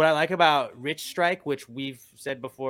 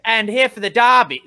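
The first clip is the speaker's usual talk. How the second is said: loud mock British "Derby" said "Darby"; a British woman correcting him; imitating her accent; 9 semitones higher, 12 dB louder than usual. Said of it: "And she's like".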